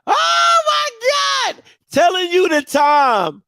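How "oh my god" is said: high, slow, drawn-out oh my God; exasperated outcry. Real, not acted. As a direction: stretch it way up in disbelief. Exasperated at how long it was.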